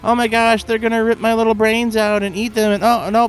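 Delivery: high-pitched hammed-up victim's lament; mock panic, pitched high. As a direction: play up the silly doomed victim. Mocks the game's pitch as victim.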